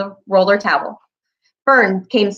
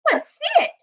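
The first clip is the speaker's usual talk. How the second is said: put-on boy's voice. A narrator's reading. Avery voiced as boy.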